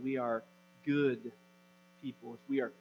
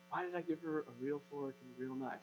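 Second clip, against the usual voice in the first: much softer, quick aside to himself; quiet, rapid self-reproach; hushed dismay; 2 semitones lower, 12 dB quieter, faster than usual. Retold or imitated. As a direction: fret quickly under your breath as a worried parent. Father's horrified inner reaction, acted.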